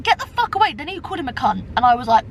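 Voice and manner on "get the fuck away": mock angry shout. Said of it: "He was like".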